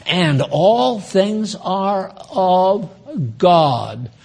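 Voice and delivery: very slow, word-by-word verse; declaimed scripture. Real, not acted. Preacher quotes scripture on all things being God's, slow declamation. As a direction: stretch each word, dropping onto God.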